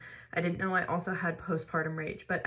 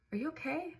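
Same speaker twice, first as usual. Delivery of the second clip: higher, softer gentle check-in; concerned soft question; 9 semitones higher, 5 dB quieter than usual. Retold or imitated. Husband gently asking his wife if she's alright, soft and high. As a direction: ask it softly, worried for her.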